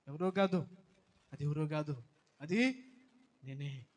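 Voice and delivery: loud, fervent sermon as God's voice; preaching at full force. Acted. Preacher voicing God to the grieving mother, loud and fervent. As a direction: thunder it out to the congregation.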